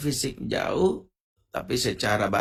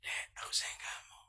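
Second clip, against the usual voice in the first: breathy lover's whisper; a lover whispering close; 17 dB quieter than usual. Shown as a demonstration. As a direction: whisper it softly like a secret. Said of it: "ngomongnya pelan-pelan".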